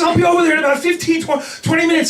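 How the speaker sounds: fast, high, loud rush; excited shout. Retold or imitated. Friend's excited call, quoted.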